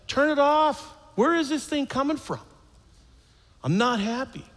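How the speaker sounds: high, exasperated plea; annoyed complaint. Retold or imitated. Kept awake in the hospital room, exasperated. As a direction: whine at the endless beeping.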